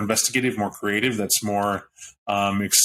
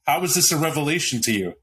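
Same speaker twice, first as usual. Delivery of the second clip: ordinary talk, nothing marked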